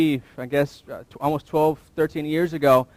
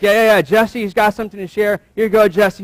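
eager friend; 5 semitones higher, 5 dB louder than usual. Retold or imitated. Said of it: "It's like".

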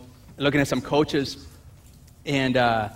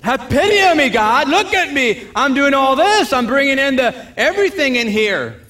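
higher, louder whiny complaint; self-pitying whine; 8 semitones higher, 5 dB louder than usual. Retold or imitated. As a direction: whine for sympathy. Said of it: "Isn't that pity?".

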